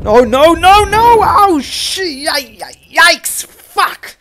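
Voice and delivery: high, very loud terrified no's and yikes; panicked screaming. Real, not acted. Player caught by the chef again, panicked scream. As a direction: scream as the monster grabs you.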